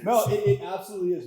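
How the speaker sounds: laughing through the words; giggling, quick and bright. Real, not acted. Laughing at his coffee joke.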